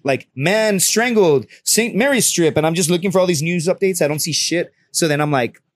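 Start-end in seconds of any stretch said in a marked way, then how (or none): 0.3-2.6 slow, drawn-out search words; slow typing voice. Retold or imitated. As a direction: spell it out as you type. Reading out search terms.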